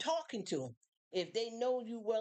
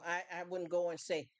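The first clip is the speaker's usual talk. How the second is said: normal talking voice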